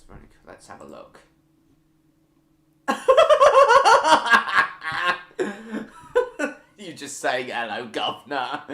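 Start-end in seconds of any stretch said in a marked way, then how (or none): 2.9-6.5 sudden high loud cackle of real laughter; loud burst of laughter. Real, not acted. Streamer cracks up reading a funny chat message, loud laughter. 7.5-8.6 a hint of Cockney, quoting a viewer; light Cockney accent. Quoting a viewer's Cockney greeting, lightly done Cockney accent. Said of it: "in a Cockney accent".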